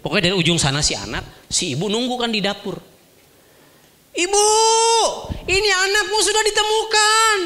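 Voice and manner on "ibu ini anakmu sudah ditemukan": high, carrying call across the distance. Acted. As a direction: shout the good news from afar. Companions calling out to mother.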